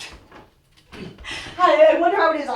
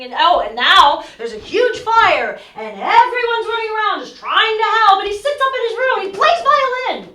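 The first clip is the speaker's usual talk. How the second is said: agitated rant; 4 semitones higher, 6 dB louder than usual. Acted. Irina's outburst about the fire.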